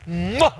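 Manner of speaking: big spoken "mwah" kiss noise; mock kiss. Preacher imitates grateful man kissing his cheek, mock kiss. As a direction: smack out an exaggerated kiss.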